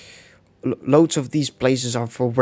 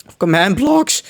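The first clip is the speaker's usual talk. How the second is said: voice crack; 7 semitones higher, 6 dB louder than usual. Real, not acted. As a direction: voice cracking up high on blocks. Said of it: "that voice crack".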